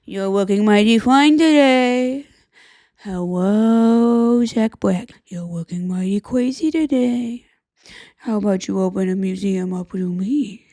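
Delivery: put-on sleazy old gentleman voice, partly whispered, drawn out slowly; creepy dandy voice. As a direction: play a leering old gent with a cane, purring compliments. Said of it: "he should be a guy that's going".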